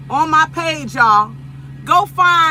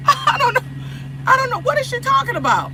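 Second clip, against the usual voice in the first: laughing disbelief; 11 semitones higher, 4 dB quieter than usual. Real, not acted.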